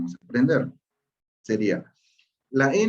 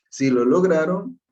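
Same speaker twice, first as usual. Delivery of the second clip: ordinary talk, nothing marked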